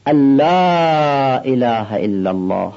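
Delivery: the لا in الا اله drawn out long, slow; stretched madd. Shown as a demonstration. Teacher showing students the long madd on لا, slow. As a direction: hold the لا long, as in tajwid. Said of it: "این مد لا رو هم بکشید".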